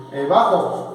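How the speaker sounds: ordinary delivery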